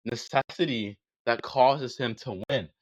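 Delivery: measured delivery with long pauses; slow and deliberate. Real, not acted. Debater walks opponent through the demand, slow and deliberate. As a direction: lay it out phrase by phrase, slowly. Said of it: "say this slowly and concisely for you".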